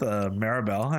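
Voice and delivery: normal talking voice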